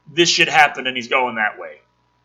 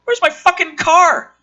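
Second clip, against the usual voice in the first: high, loud outraged yell; furious high shout; cop shouting; 7 dB louder than usual. Acted. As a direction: bellow it like a cop whose car just vanished. Rookie cop finds car gone.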